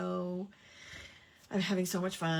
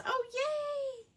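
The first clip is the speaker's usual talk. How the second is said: excited. Real, not acted. Delighted reaction to viewer's comment.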